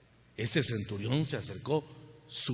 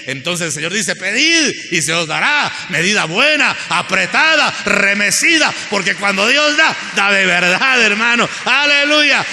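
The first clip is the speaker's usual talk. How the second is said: loud, higher preaching cry; full-force preaching; 8 semitones higher, 13 dB louder than usual. Acted. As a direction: preach it out to the whole church. (predicación a todo pulmón, más alta y fuerte)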